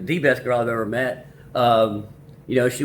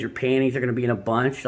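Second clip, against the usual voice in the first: usual speaking voice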